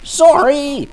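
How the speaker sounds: put-on high, overexcited teen yell; shouting. Student apologizing to lawyer for shouting, while shouting high. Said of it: "can't you talk without shouting?".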